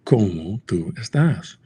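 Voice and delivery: slow, careful classroom Spanish; slow kids-show Spanish; textbook Spanish. Shown as a demonstration. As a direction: spell it out like Dora the Explorer. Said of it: "Dora Explorer".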